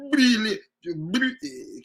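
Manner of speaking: REALLY as a stretched, buzzing B attempt; trying to draw B. Shown as a demonstration. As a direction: slowly force out the B sound in REALLY.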